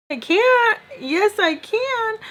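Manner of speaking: high-pitched, drawn-out mock protest; defiant retort. Retelling her answer to being told she can't leave, mock indignant.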